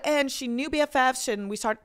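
ordinary talk

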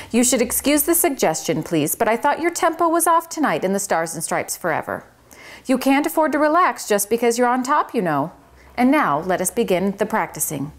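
high, fast-talking fussy little mouse; a busy little manager setting the rules. Acted. Tucker lectures Chester on tempo.

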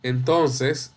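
ordinary delivery

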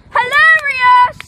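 shouting sarcastically. Acted. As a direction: yell it sarcastically. Mock outrage at prank.

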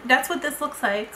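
ordinary talk, nothing marked

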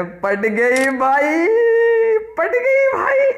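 gleeful drawn-out squeal; delighted. Real, not acted. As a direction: drag it out, thrilled she's won over. Pet seems won over.

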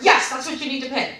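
loud, emphatic confirmation; impatient imitation. Rep confirming total to customer, impatient. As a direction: hammer it home as the rep.

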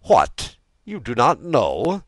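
high, loud rebuke; incredulous royal scolding; indignant. A narrator's reading. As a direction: demand it in angry disbelief. King rebukes ignorant minister.

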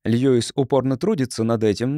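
usual speaking voice

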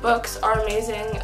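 usual speaking voice

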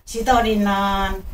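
usual speaking voice

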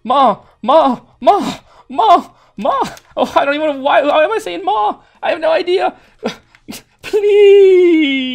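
very high, loud frantic cries with a laugh; shrieking for mom mid-fall; panicked calling for mom. Real, not acted. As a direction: wail for your mother in panic, laughing at yourself. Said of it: "I was calling out for my ma".

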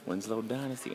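ordinary talk, nothing marked

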